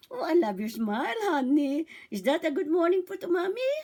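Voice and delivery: put-on lilting, rising baby talk; mother to baby. Shown as a demonstration. Mother admiring her smiling baby, rising singsong coo. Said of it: "nakikipag-smile na po yan".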